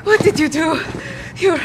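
tearful, broken question; crying. Acted. Mother weeps at the captor over her son, crying. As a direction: sob it out in disbelief.

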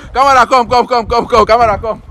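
high, loud repeated summons; calling out. Real, not acted. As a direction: yell urgently for the camera crew. Summons camera crew.

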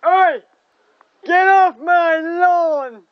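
slow, loud grumpy yell; shouting at trespasser. Pretend. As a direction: bellow at the intruders, dragging it out.

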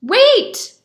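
high, loud sudden call. A narrator's reading. Beggar calling after the running Shamus, loud high call. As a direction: call someone back urgently. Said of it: "called the beggar".

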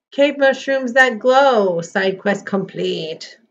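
slow, loud game announcer. Acted. Reads game banner slowly, loud.

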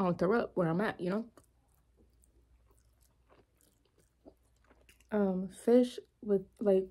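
slight chewing while speaking; talking between bites. Real, not acted. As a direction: food held near mouth. Seen 0: She holds spaghetti on a fork, not fish, between bites.

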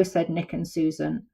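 ordinary delivery, nothing marked

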